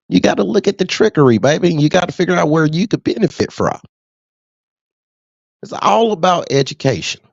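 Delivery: ordinary talk, nothing marked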